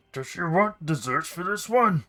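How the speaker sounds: high, loud servant voice; nervous kitchen servant. Acted.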